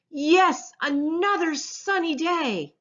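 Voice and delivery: bright, loud cheer; cheering turtles. A narrator's reading. Turtles cheering on the beach, high loud cheer. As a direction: cheer the sunny day. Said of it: "The turtles cheered".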